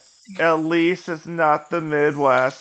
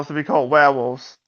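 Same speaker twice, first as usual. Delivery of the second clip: "werewolves" with both R's dropped, Boston style; Boston accent; 4 semitones lower than usual. Shown as a demonstration. Player correcting the name, "werewolves" in an R-less Boston accent. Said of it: "We got the letter R".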